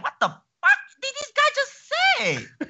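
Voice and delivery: high, squeaky, laughing disbelief; baffled outburst. Retold or imitated. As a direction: squeal it in mock confusion, laughing. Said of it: "I'm like".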